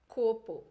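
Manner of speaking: copo, with a closed O, said loud; Spanish closed O. Shown as a demonstration. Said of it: "sempre com O fechado". (copo em espanhol, com O fechado)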